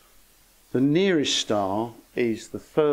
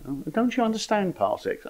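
higher-pitched, smug mock astronomer; put-on condescending surprise, pitched up; 9 semitones higher than usual. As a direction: ask it with mock smug superiority. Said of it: "feel ever so smug and say".

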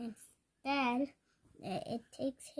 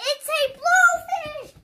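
excited exclamation; 13 dB louder than usual. Acted. Blowfish pops up.

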